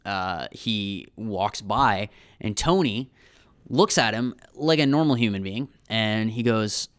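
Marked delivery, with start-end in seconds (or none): none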